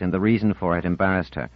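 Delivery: ordinary delivery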